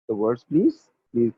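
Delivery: ordinary talk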